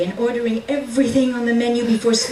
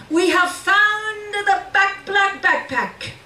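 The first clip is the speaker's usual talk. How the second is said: higher, slow, grand official's voice; police chief voice; 10 semitones higher, slower than usual. Acted. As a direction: announce it like the proud chief. Police chief announces find.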